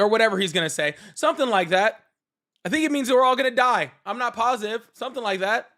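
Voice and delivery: ordinary talk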